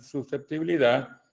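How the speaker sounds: normal talking voice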